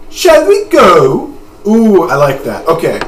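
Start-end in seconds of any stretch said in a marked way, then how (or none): 0.1-1.3 high, drawn-out British accent. Shown as a demonstration. Trying British for Haschel's invitation to go, high slow British accent. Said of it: "Uh, can I do British?".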